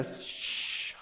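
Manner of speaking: long drawn-out shush; a slow, hushing "shh". Pretend. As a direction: shush the noisy distraction slowly. Silencing the phone's distractions.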